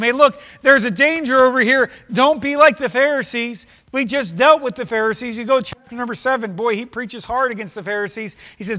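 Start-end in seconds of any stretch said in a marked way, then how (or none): 0.0-3.7 urgent warning. Acted. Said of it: "Jesus is telling them".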